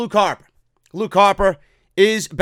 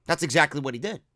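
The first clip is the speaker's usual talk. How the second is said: ordinary talk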